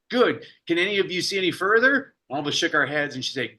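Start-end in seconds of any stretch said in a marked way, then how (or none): 0.1-2.1 lightly voiced teacher, a bit higher and bright with purpose; teacher addressing class. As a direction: call the class like a lively schoolteacher.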